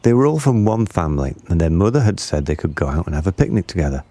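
plain reading voice

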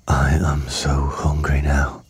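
slow, low crocodile whisper; hungry whisper to self. A narrator's reading. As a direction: whisper slowly, aching with hunger. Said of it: "he said to himself".

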